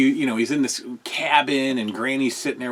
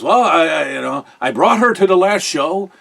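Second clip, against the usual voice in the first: put-on lightly done Canadian accent, unsure; a hint of a Canadian accent, a bit helpless; 4 semitones higher, 5 dB louder than usual. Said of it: "heavy Canadian accent".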